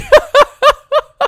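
shrieking laugh, high and loud; high burst of laughter. Real, not acted. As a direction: let a big squeal of laughter out. Laughing at faces.

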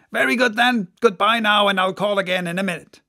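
cheerful quick goodbye; Stubb. Acted. Stubb bids the mate goodbye, cheerful and rushed.

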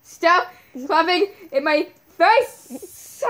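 real annoyed yell at a friend clapping in their face; annoyed shout. Real, not acted. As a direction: yell at them to stop.

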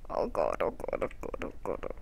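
panicked whispered oh god; breathless rising panic; scared. Real, not acted. Ghost about to catch him.

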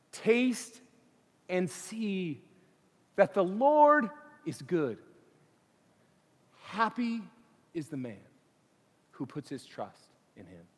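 deliberate verse, broken by long pauses; solemn scripture reading. Acted. Preacher recites the psalm that converted a man, solemnly.

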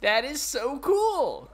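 high, slow starstruck gush; awestruck gushing. Pretend. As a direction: swoon over the hero. Gushing fan awe.

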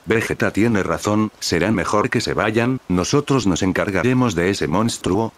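ordinary delivery, nothing marked

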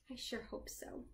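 whisper. Real, not acted. Storyteller's hopeful aside to children.

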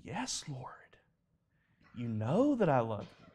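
hushed, whispered first words, pained reply; grieved. Retold or imitated. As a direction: answer softly as a grieved Peter. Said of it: "Peter was grieved in his spirit".

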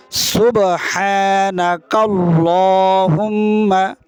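সুবহানাকাল্লাহুম্মা stretched very slowly, হা from the throat; slow recitation demo. Shown as a demonstration. Teacher asks students to repeat after him, slow recitation. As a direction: draw out সুবহানাকাল্লাহুম্মা syllable by syllable. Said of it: "আবার আমার সাথে বলেন".

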